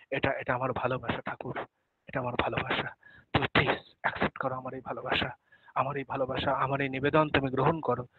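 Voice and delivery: soft, pleading murmur of devotion; tender hushed prayer. Pretend. Devotee pleading with Thakur to accept the offering, soft and tender. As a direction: offer your love quietly, almost under the breath.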